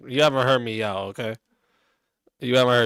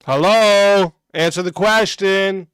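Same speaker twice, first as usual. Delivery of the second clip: mocking taunt; 5 semitones higher, 4 dB louder, slower than usual. Real, not acted. Taunting a chatter on stream.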